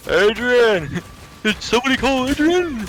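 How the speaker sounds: hammed-up boxer call; Balboa yelling Adrian. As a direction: call out for her like a goofy boxer. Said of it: "a Rocky Balboa thing".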